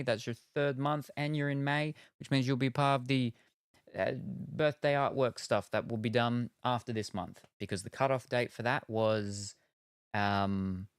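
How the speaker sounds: ordinary talk, nothing marked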